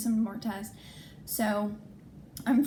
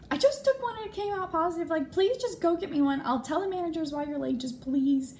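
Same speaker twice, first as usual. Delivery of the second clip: high, pleading, near panic; panicked plea; 8 semitones higher than usual. Retold or imitated. To her friend, begging for pregnancy tests, high and panicked. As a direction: beg your friend urgently, voice climbing high.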